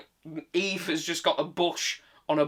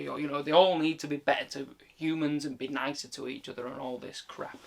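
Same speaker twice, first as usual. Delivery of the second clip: ordinary talk, nothing marked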